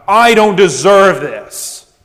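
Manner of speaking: proud grumbling. Acted. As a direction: sulk at God like you're owed better. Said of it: "grumbling of pride and arrogance".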